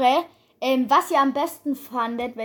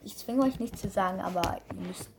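normal talking voice

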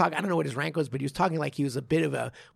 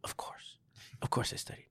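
mock whisper; 11 dB quieter than usual. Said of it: "And they go".

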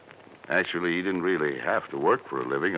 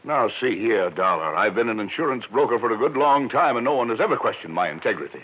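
raised, louder, higher protest of injured pride; indignant outburst; 7 semitones higher, 7 dB louder than usual. Acted. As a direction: bristle and push back, loud and fast by the end. Accused broker blows up at Dollar.

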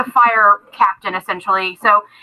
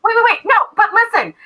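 high, urgent calls after her; hurried, pleading halfling catching up; Kita pleading; 11 semitones higher, faster than usual. Acted. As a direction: chase her down with your voice, much higher. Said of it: "running after her is Kita going".

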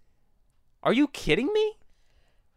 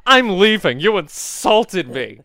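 loud, drawn-out mock indignation; mocking offended exit; 7 dB louder, slower than usual. Grandson mimicking grandma storming out of Shark Tank, loud mock offence. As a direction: exaggerate the outrage, stretching 'insulted'. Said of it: "Instead of being like".

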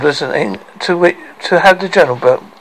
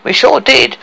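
high, boastful dwarf; proud; 11 semitones higher, 4 dB louder than usual. Acted. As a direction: own up to it proudly. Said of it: "said Tricky proudly".

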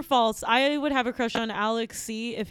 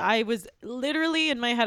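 usual speaking voice

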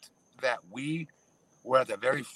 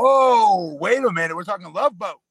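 excited; 7 semitones higher, 13 dB louder than usual. Real, not acted. Realizes it's a Love Boat question.

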